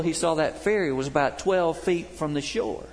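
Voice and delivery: normal talking voice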